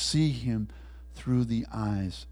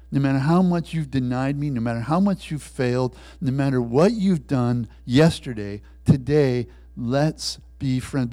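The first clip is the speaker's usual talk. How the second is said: louder, driving, building repetition; emphatic appeal; 2 semitones higher, 6 dB louder than usual. Acted. Christ offers friendship despite every failure, emphatic.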